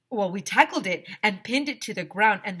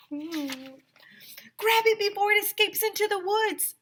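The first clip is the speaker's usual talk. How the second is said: put-on high, fast, urgent kid yell; frantic shout; 3 dB louder than usual. Michael shouting at the kids to grab the zombie. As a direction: shout before it gets away. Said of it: "shouted Michael".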